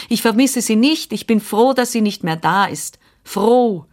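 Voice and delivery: high, insistent, desperate protest; narrated child's defiant despair; desperate cry. A narrator's reading. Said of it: "schrie ich verzweifelt".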